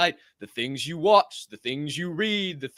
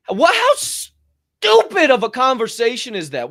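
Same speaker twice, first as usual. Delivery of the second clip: higher and louder, slow disbelief; incredulous outburst; 8 semitones higher, 7 dB louder, slower than usual. Real, not acted. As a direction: scoff at how dumb it is. Mocking boring small talk.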